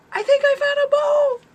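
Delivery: very high, loud squeal of excitement; re-enacted excited squeal. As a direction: squeal the big news at top pitch. Re-enacting her excited scream.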